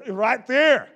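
loud laughing recall. Real, not acted. Pastor laughing about a seasick friend, loud laughter. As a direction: laugh through it, disgusted.

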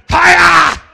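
loud, high "Hiya!" yell; karate battle cry. Acted. Preacher yelling a karate cry at trouble. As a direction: shout it like a martial arts strike.

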